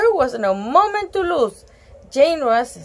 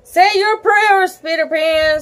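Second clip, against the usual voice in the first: higher, louder villain threat; evil pirate; 7 semitones higher, 5 dB louder than usual. Acted. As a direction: gloat like a menacing pirate captain. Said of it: "said Hook with an evil laugh".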